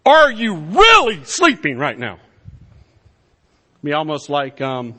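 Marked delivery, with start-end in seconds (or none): 0.1-2.2 loud, high disbelieving outburst; can't believe he's asleep, shout it; incredulous captain. Acted. Captain finds Jonah asleep, loud disbelief. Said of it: "his response to that is basically".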